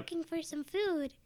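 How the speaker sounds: cute rat voice. Pretend. Voicing the rats.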